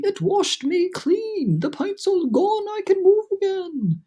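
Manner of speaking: high, slow ecstatic exclaiming; overjoyed. A narrator's reading. Centipede free to move again, high joyful. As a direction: bubble over with glee.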